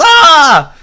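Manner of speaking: loud, high imitated scream; hammed-up yell of a man under attack; cartoonish cry of terror; mock scream. Imitated cry of man being beaten.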